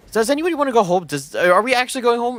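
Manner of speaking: blurting out in frustration; annoyed outburst. Retold or imitated. Fed up with family dithering over leaving, exasperated loud outburst. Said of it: "I got annoyed by that".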